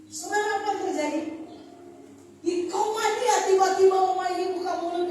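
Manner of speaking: rising preacher's suspense; preacher's climax. Real, not acted. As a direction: hold them on the edge, then push. Climax of the miracle story.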